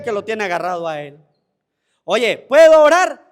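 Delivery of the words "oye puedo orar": louder rhetorical question. Acted. Preacher asks congregation a question.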